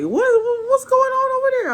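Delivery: high, squeaky mock of her date; imitating him. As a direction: squeak it out like a nosy guy teasing. Mocking her date's question.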